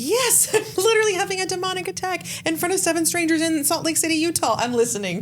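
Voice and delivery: high-pitched hammed-up panic mid demonic attack; mock panicked. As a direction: squeal it like you're under attack. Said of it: "And I'm like".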